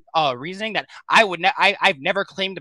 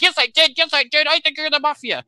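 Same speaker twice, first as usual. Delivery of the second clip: high, loud, hammed-up denial; mock indignant Keith; 10 semitones higher, 7 dB louder than usual. Player mocking Keith's defensive outburst, loud mock protest. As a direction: mimic him shouting back, overplayed and much higher. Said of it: "And he goes".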